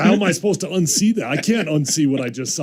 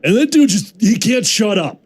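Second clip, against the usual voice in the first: louder, higher exasperation; exasperated; 6 semitones higher, 4 dB louder than usual. Real, not acted. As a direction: blurt it out, fed up. Exasperated rant about Trent.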